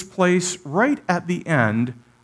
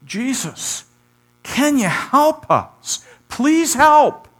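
much higher and louder, pleading to be saved; desperate cry for help; 12 semitones higher, 6 dB louder, much slower than usual. Pretend. Disciples begging Jesus from the sinking boat, desperate higher louder cry. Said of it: "They didn't ask or cry out".

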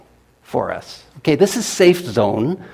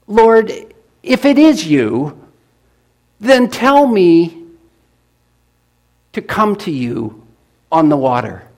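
eager call; 4 semitones higher, 4 dB louder than usual. Acted. Peter calling to Jesus.

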